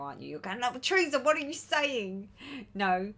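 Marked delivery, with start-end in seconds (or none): 0.6-2.3 higher, exasperated mock shout; raised, pleading heckle; imagined viewers shouting. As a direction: shout at the host in mock exasperation, stretching the end. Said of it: "you're sitting there just shouting now".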